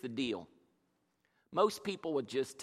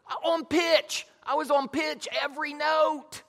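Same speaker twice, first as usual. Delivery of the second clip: higher, louder, hammed-up wounded protest; mock indignation; 9 semitones higher, 5 dB louder than usual. Preacher protesting to his wife that he sang on pitch, mock-hurt. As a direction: defend yourself with comic outrage.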